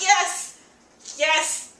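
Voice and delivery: loud, high, triumphant yes; jubilant cheer. Retold or imitated. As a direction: cheer it like a prayer just got answered. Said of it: "I was praising the old gods".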